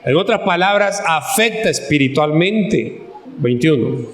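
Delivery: ordinary delivery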